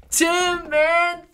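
high, loud call of a name; excited call. Real, not acted. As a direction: sing out the name. Shouting out a super chat.

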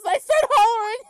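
screamed high and loud; full-throated mock yell; hollering Undyne. Undyne yelling at a kiss request, shrill shout. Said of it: "that's when she goes".

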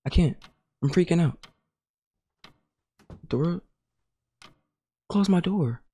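soft, slightly high frightened murmur; quietly freaking out; scared murmur. Real, not acted. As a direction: hush your voice in fear. Frightened after the door opens.

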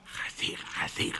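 slow, voiceless secret whisper; mock whisper. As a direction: whisper a hushed warning into her ear. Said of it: "אף אחד לא אומר לה". (לחישה איטית כאזהרה סודית)

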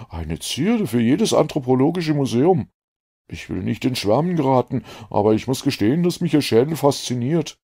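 put-on a keen, relieved young man; a lightly voiced eager doctor. A narrator's reading.

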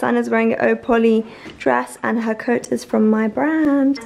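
ordinary delivery

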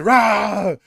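loud imitated roar; Hulk roar. Hulk roaring to wake Iron Man, loud imitated roar. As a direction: belt out a beast's roar. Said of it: "And Hulk just roars".

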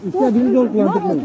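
loud stern warning; angry warning. Real, not acted. Man warning the crowd, loud angry warning. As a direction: warn them off loudly.

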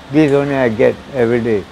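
ordinary delivery

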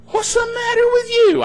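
high-pitched, wailing mock disbelief; mock upset. Worried relatives scolding the calm believer, high mock upset. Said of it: "they get all bent out of shape".

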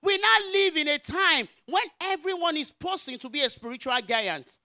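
loud, high, emphatic proclamation; forceful preaching. Real, not acted. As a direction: drive each phrase out to the congregation.

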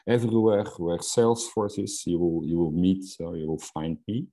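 ordinary talk

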